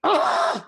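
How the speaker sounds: high startled gasp; quick loud gasp; sharp gasp. Shown as a demonstration. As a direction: gasp as if interrupted. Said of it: "I'm speaking fast".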